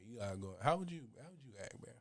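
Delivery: ordinary talk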